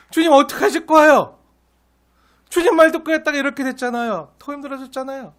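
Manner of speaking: protesting prayer. Retold or imitated. Moses protesting to the Lord, a high, loud, exasperated plea. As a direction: cry out to the Lord in exasperated complaint. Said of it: "주님 앞에 막 항변하는 거예요".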